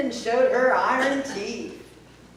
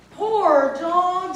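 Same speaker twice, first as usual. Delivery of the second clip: put-on girl's voice; 6 semitones higher, 6 dB louder than usual. Sasha pities the barking dog.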